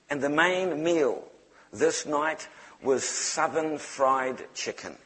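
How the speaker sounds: ordinary talk, nothing marked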